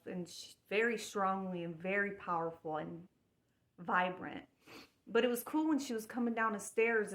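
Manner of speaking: usual speaking voice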